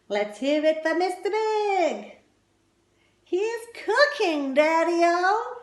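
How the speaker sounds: high, loud hipster announcer; loud excited daddy-o shout; emcee hype. Acted. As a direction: whip up the room. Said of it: "the audience were calling out".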